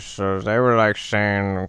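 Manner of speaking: usual speaking voice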